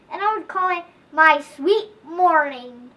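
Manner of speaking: ordinary delivery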